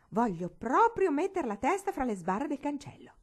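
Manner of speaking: loud, high, determined declaration; firm and defiant, pushing every word; resolute. A narrator's reading. Gwendolyn defies her grandmother's rule, loud and determined. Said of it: "disse risolutamente".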